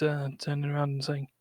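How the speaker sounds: ordinary talk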